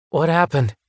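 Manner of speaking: groggy kid waking up, lightly voiced; dazed boy. A narrator's reading. Boy waking after CPR asks, lightly voiced and dazed.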